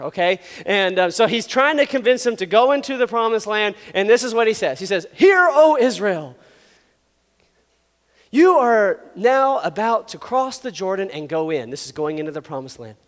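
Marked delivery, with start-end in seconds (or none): 5.1-11.7 slow, loud proclamation; mock-grand address, words stretched out; motivational speech. Moses rallying Israel before the promised land, mock-grand proclamation. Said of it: "he's a terrible motivational speaker".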